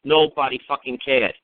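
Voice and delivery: usual speaking voice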